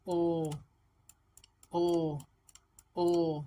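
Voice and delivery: ओ held long as "ohh", repeated; vowel sound drill. Shown as a demonstration. Teacher shows how to practise with sounds, drawn-out ओ. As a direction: hold each ओ separately, evenly. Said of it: "sounds के साथ आपने अपनी practice करनी है".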